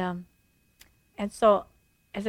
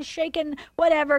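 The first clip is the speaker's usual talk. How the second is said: ordinary talk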